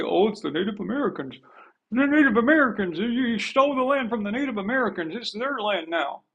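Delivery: mocking imitation. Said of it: "you say".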